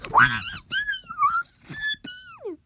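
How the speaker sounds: very high, loud yell at teammates; exasperated yell. Real, not acted. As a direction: shriek at the teammates. Yelling at teammates.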